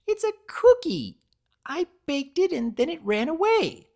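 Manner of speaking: very high, loud bubbly voice; Pinkie voice. A narrator's reading. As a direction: chirp it like an excitable pony.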